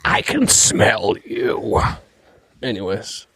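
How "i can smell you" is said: slow breathy whisper of a lurking beast; slow menacing whisper; Cumberbatch dragon voice. Retold or imitated. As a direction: hiss it out slowly like a dragon sniffing prey. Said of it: "He's like".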